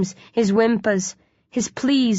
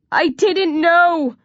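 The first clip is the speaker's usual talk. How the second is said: slow, high wail; 9 semitones higher, 3 dB louder, much slower than usual. A narrator's reading. Trembling boy to his attackers, wailing. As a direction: wail it out, drawn long. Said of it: "Mark wails".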